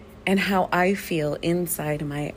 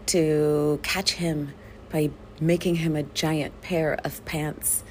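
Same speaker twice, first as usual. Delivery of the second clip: ordinary delivery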